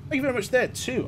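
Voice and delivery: high, excited thank-you; high chirpy thanks to the donor; bright thanks. Real, not acted. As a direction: pitch it up to thank a supporter.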